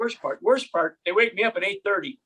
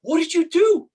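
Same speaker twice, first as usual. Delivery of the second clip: higher, louder imitation of an accusing wife; retold accusing question; 7 semitones higher, 5 dB louder than usual. Wife confronting her husband in a story, mock accusing. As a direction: put mock reproach into it, pitched up.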